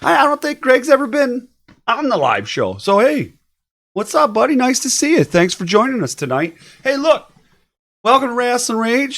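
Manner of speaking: upbeat host welcome. Real, not acted. Host's upbeat welcome to guest.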